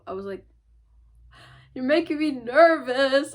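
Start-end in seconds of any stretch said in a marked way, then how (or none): 1.7-3.3 higher, louder, slower mock panic; mock nervous protest. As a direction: play up the nerves, half joking. Nervous reply to cashier.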